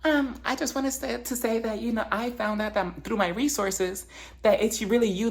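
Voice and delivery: high, halting imitation; mock careful accusation. Woman confronting Lisa over rumors, high tentative voice. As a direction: pitch it up and tread delicately.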